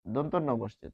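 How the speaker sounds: usual speaking voice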